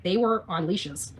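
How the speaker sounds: imitating a flat insistence. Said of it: "He's like".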